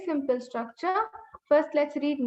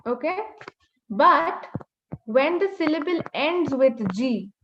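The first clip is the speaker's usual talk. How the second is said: ordinary delivery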